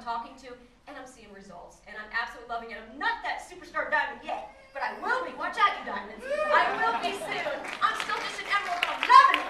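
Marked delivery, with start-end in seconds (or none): 2.8-9.5 loud, rising cheer to the crowd; excited rally. Real, not acted. Speaker welcoming new members at the event, excited and loud. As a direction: pump up the room as you finish.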